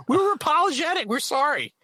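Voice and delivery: very high-pitched, indignant apology; exasperated protest. Retold or imitated. Man retelling backyard row with neighbor, exasperated protest. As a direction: protest as if pushed past your limit.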